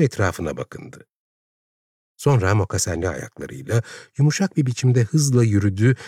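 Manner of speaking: ordinary delivery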